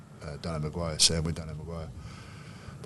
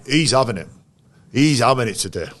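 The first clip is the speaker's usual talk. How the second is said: raised, louder imitation of a fired-up player; mock fighting talk; 6 semitones higher, 6 dB louder than usual. Player singling out an opponent before the game, imitated with loud fighting resolve. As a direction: punch out the threat as a teammate would.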